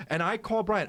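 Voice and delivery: ordinary delivery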